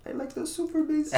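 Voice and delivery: put-on high, thick Spanish accent; heavy Spanish accent. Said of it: "like, a heavy Spanish accent".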